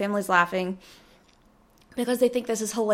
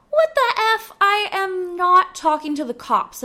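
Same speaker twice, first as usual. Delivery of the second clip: much higher, louder indignant outburst; high, loud teenage outrage; outraged refusal; 9 semitones higher, 4 dB louder than usual. Retold or imitated. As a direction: blow up at the manager's request. Reacting to the manager's call.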